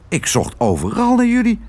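hoge kraaienstem; kraai Kwark. Acted. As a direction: praat hoog, als een pratende kraai. (hoog kraaienstemmetje)

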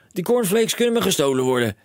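high, loud brush-off; no time to wait; impatient cry. A narrator's reading. Father cutting off his son, high impatient call. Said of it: "riep meneer Krakeel".

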